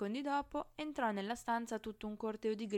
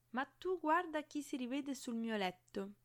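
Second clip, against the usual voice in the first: higher, lightly sing-song greeting; chirpy teasing; 6 semitones higher than usual. A narrator's reading. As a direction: chirp it as a playful tease. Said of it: "cinguettò la voce del proprietario". (cinguettio scherzoso)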